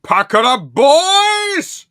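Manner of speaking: very high, loud crying-out yell; mock excited shout. Fan quoting Douglas rallying the boys, shrieking. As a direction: shriek it like a hype call. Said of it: "when he's like".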